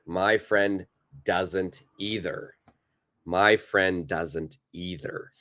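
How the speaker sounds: slow, evenly spaced words; slow model sentence. Shown as a demonstration. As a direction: say it slowly for learners to copy. Teacher models sentence slowly.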